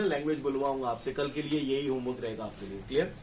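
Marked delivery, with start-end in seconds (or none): none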